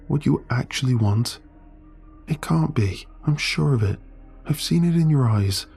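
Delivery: desperate friend begging her to see; Sam pleading. Acted.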